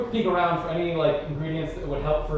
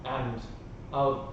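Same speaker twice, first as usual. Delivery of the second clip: ordinary delivery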